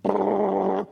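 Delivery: loud rolled brrrr like a woodpecker pecking; woodpecker drumming noise. Retold or imitated. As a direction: rattle the tongue like a woodpecker on a tree. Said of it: "the woodpecker goes".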